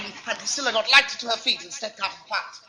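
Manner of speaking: ordinary talk, nothing marked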